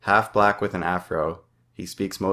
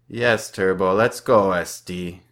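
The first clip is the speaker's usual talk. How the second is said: taunting; 5 dB louder, slower than usual. A narrator's reading. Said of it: "Alex says".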